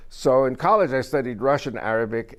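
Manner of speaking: ordinary talk, nothing marked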